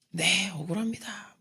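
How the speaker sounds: slow breathy whisper of grievance; slow whispered grievance to God; hushed complaint. Retold or imitated. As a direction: whisper it slowly, hurt. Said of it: "막 그랬습니다".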